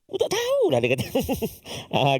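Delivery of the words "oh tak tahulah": put-on very high squeaky child voice; child's voice. Student's confused reply in the teacher's joke. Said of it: "dia tanya".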